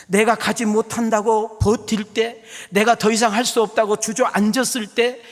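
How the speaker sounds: high-energy sermon appeal; impassioned preaching. Real, not acted. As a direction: press urgently through each phrase. Preaches at full force again.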